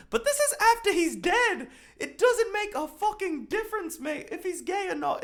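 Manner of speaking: high, loud, incredulous rant; worked-up high-pitched protest; exasperated outburst. Real, not acted. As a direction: fire it off in disbelief.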